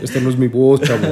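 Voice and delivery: hammed-up femme fatale; sensual voice. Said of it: "Ahorita anda con voz sensual".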